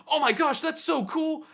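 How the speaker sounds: high, loud burst of delight; excited gushing. Retold or imitated. Visitor spots handmade maps at a stall, excited squeal.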